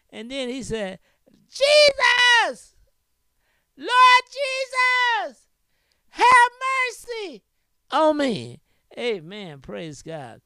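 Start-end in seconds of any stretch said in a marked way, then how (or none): 1.5-8.6 high, loud desperate plea; blind man crying out. Acted. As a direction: cry out to Jesus for mercy, drawn out. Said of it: "He cried out the more".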